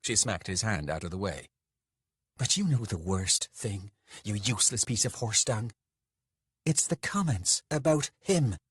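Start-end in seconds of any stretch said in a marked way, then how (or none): 2.4-8.6 contained anger rising in pitch to the end; angry lover. Acted. Lover tells Beatrix the worst thing, mildly furious. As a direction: accuse him, building to the last words.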